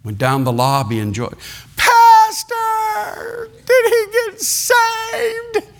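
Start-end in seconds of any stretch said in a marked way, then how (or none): 1.8-5.7 very high, slow, crying plea; tearful cry. Retold or imitated. Joy crying out to the pastor, very high and tearful. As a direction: call out through tears, desperate to know.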